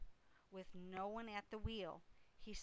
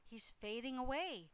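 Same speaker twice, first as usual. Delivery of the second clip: higher, louder storybook cry; Fern's scream; 5 semitones higher, 5 dB louder than usual. A narrator's reading. Girl crying out that the pig is fainting, mild scream. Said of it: "screamed Fern".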